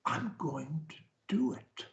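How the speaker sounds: breathy unvoiced whisper of the line. Shown as a demonstration. Coach showing a client the whisper, hushed. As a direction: drop to a whisper to show the range. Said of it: "whether I whisper it".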